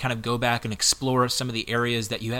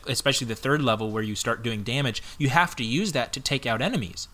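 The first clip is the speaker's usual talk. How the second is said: ordinary talk, nothing marked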